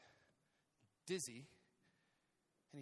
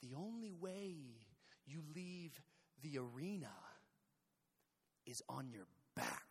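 put-on old Spaniard; 6 semitones lower, 8 dB quieter than usual. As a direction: deliver a quiet, ominous warning. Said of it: "was this, was this old Spaniard".

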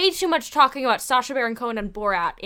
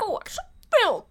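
slow, high mock British voice; drawn-out British mimicry, pitched up; mimicked British accent; 11 semitones higher, 5 dB louder, slower than usual. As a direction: echo it in an exaggerated British accent.

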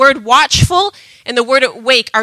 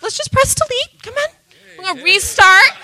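comforting mom; 7 semitones higher than usual. Retold or imitated. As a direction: coax him gently back to a fresh start. Mother comforting her son.

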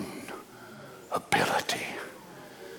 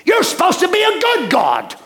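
complaining attitude; 8 semitones higher than usual. Retold or imitated. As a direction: whine at God like a sulking believer. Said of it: "Where's his attitude?".

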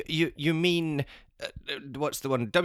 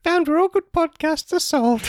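put-on high loud child shout; little kid voice; 7 dB louder than usual. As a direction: squeal like an excited kid. Child character.

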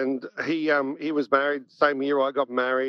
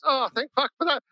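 higher-pitched imitation of a relieved reply; retold relief; 8 semitones higher than usual. Wife hearing the mate has come out, relieved. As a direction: voice her relief, pitched well up. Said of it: "really relieved for this".

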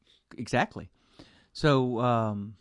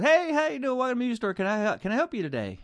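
high, bright salesman greeting; friendly salesman; 10 semitones higher, faster than usual. Retold or imitated. Store clerk greeting customers, high and eager. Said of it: "And the guy goes".

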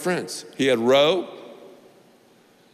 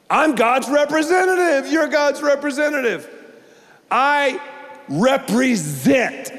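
rising preaching; 9 semitones higher than usual. Real, not acted. Preacher building to a climax.

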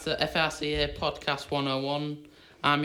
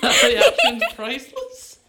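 laughing; 12 semitones higher, 12 dB louder, slower than usual. Real, not acted. Host laughing at impression.

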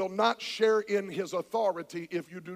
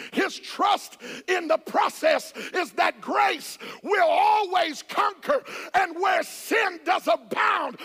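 full-force preaching; 4 semitones higher than usual. Acted. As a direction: lean into each word of grace.

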